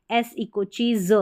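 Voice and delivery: ordinary delivery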